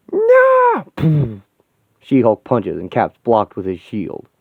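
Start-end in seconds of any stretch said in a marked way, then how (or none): 0.1-1.4 very high, loud scream of refusal; enraged scream. Acted. She-Hulk screams no as she rips Vision apart. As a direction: scream it with everything.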